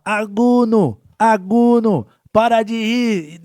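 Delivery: loud, high, slow lisping scold; lisping instructor yell. Retold or imitated. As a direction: shout like a tongue-tied drill sergeant. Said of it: "tinha a língua presa pra falar a, a letra R".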